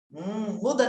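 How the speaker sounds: normal talking voice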